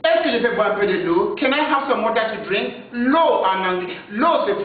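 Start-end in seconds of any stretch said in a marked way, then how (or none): 0.0-1.4 Est-ce que je peux boire un peu de l'eau said quickly, at natural speed; fast. Shown as a demonstration. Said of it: "slow then fast repetition". 1.4-2.7 Can I have some water to drink said quickly; fast. Shown as a demonstration. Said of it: "fast repeat of line 102".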